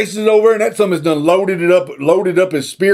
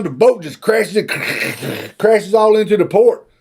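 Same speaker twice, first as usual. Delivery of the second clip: usual speaking voice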